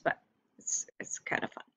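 soft secret whisper. Real, not acted. Aside to those not watching.

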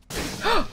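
sharp high gasp of fright; startled gasp. Real, not acted. Gamer startled by something running past. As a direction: jump at a sudden scare.

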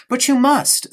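put-on a boy annoyed his friend won't agree; petulant child protest; irritable. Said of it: "said Bruno irritably".

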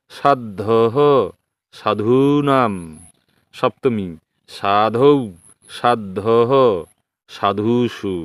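sing-song drill of সাধুভিহি and সাধুভ্যহ with a breathed হ at the end; Sanskrit declension recitation. Shown as a demonstration. Teacher recites সাধু forms for students to copy, visarga as হ. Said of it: "দেখো আমি উচ্চারণ করে দিচ্ছি".

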